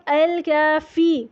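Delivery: الکافی slow with air on the ف; breathy fa. Shown as a demonstration. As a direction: let breath escape on فا. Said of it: "فا پہ تھوڑا سا ہمس کے ساتھ".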